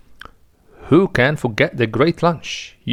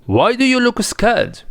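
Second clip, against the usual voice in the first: teasing inspector; 8 semitones higher than usual. Acted. Inspector mocks the nervous superintendent.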